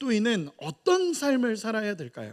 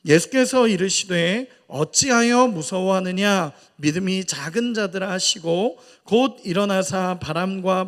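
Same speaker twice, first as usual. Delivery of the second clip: slow, louder solemn Bible recitation; scripture reading aloud; 4 dB louder, slower than usual. Acted. Preacher leading the congregation in reading, slow solemn recitation. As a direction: read the verses together, slow and measured.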